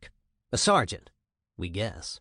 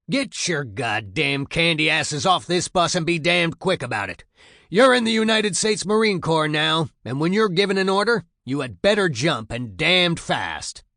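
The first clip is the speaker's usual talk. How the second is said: loud, high, gruff drill sergeant; impatient yell; 5 semitones higher, 6 dB louder than usual. A narrator's reading. Gruff voice orders new recruits off the bus, impatient shouting. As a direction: berate them impatiently, slowing hard on the last threat. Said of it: "sounding very impatient now".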